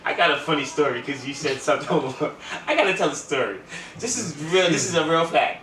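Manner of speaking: laughing through the words, loud and high; giggling while starting a story; excited laughing buildup. Real, not acted. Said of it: "Yo, I'm laughing".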